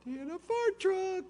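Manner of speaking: put-on high excited kid telling news; high child's eager report; little boy voice. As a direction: bubble it out like a thrilled son. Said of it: "He goes".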